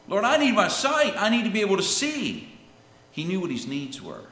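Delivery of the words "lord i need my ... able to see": loud, high desperate plea, acted out; crying out louder. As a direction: cry out the need as the blind beggar would. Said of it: "he just cried out louder and louder".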